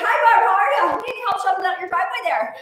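hammed-up Minnesotan neighborliness; Minnesota mom accent. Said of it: "we did that Minnesota mom reel".

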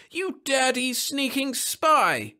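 high, drawn-out outraged yell. A narrator's reading. Caught man insulting the monitor, yelling. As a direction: yell the insult, stretching each word. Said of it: "yelled the man".

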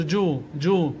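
"جو" with a rounded "oo" vowel; damma sound. Shown as a demonstration. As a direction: sound out "جو" as "joo". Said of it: "بالضمة هذه حركة الضمة".

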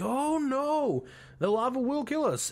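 hammed-up terror at the sky; mock panic. Fortune teller seeing the skull cloud, mock high panic. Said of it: "Madame Wu sees it and like".

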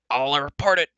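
loud, outraged dwarf threat; Dolly, crying out. A narrator's reading. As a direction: shout it in high indignation. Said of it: "Dolly cried".

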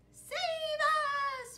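very high loud plea; crying for help. Acted. Jailed pirates plead to their captain, crying out. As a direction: beg to be rescued. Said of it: "cried the pirates".

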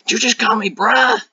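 very high, loud mock-indignant teacher; horrible geometry teacher impression. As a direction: squawk it as a badly imitated, offended teacher. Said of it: "that's a horrible impression of her".

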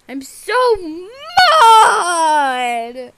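loud play-acted wail; tantrum. Throwing a fit before onlookers, drawn-out tantrum cry. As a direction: drag it out in mock fury. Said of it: "is she having a tantrum".

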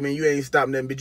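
ordinary talk, nothing marked

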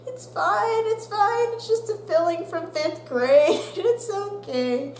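breezy reassurance. Retold or imitated. Student brushing off teacher's worry, breezy. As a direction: wave it off casually. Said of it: "I'm like".

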